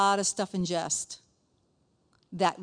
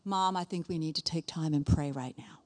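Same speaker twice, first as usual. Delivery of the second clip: lower, careful imitation of a child; son's gentle suggestion; 4 semitones lower than usual. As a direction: gently suggest it to an impatient mom. Voices her young son's gentle suggestion.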